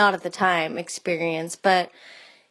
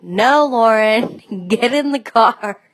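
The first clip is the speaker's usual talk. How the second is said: insistent; 3 semitones higher, 8 dB louder than usual. Retold or imitated. Parents insist Lauren gets in.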